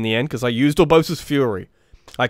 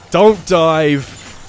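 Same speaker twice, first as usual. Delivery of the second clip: pleading; 3 semitones higher, 7 dB louder than usual. Real, not acted. Pleading with the game.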